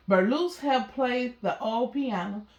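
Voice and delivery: plain reading voice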